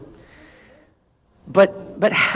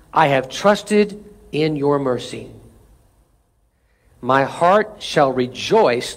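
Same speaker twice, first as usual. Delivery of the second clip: slow solemn scripture; 6 semitones lower, much slower than usual. Acted. Slow, low scripture reading.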